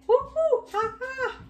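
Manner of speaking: loud, very high monkey hoots; monkey call. Pretend. Teacher reading along with children, monkey call. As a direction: whoop it out like an excited monkey. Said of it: "I wanna do a monkey voice".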